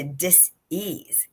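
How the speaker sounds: slow DIS-EASE, broken into "dis" and "ease"; split-word pronunciation. Shown as a demonstration. Coach explaining what unspoken words lead to, split-word pronunciation. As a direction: say "dis-ease" as two separate words, slowly.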